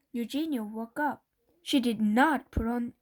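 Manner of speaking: usual speaking voice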